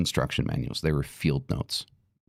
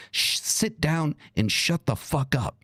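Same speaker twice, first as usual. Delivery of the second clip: higher and slow, spelling it out; slow, contemptuous put-down; fed-up command; 10 semitones higher than usual. Real, not acted. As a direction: order them to sit down, scornfully. Dismissing critics with contempt.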